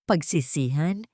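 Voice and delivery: mocking scoff. A narrator's reading. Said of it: "Tumawa ng mapanguyam".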